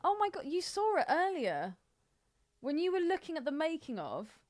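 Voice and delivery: high, exasperated oh my God; exasperated disbelief. Real, not acted. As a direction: throw up your hands in disbelief. Exasperated at friend forgetting.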